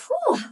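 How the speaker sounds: high, sharp whew blown out in effort. Real, not acted. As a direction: blow out the strain with a high whew. Exhale mid workout.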